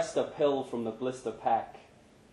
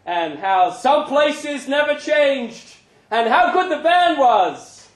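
lifted, louder narration over the crowd; raised narration; 13 semitones higher, 12 dB louder than usual. A narrator's reading. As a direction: carry it up like a stage announcement. Stage banter recalled, raised voice.

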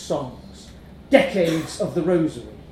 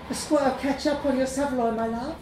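higher, soft, quick and sweet; soft Greek girl voice; 9 semitones higher than usual. A narrator's reading. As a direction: coo it like the counter girl. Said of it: "in a soft voice".

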